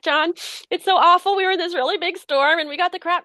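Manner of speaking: high, tearful lament; tearful retelling. Retold or imitated. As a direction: pour out the ordeal, pitched high and upset. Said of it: "I start tearing up".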